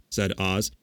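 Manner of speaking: usual speaking voice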